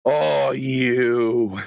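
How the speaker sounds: low, drawn-out accusing words; slow pretend fury, pitched well down; mock seething. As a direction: drop low and stretch each word with menace. Wordless seething at the culprit.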